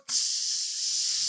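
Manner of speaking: long unvoiced "tss" like a spray; spray hiss. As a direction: imitate the freezer spraying. Imitates the freezing spray sound.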